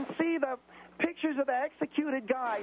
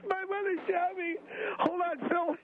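crying in shock; 5 semitones higher than usual. Acted. Just been shot by mother.

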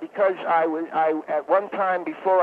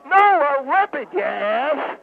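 exasperated; 7 semitones higher, slower than usual. Acted. Exasperated correction of caller.

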